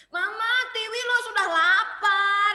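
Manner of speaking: high, loud hungry whine; child whining. Acted. Hungry child calling to mom, high and loud whine. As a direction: complain loudly like a hungry kid. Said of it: "dia bilang gitu kan".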